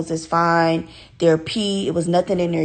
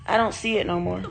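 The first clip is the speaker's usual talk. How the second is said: ordinary talk, nothing marked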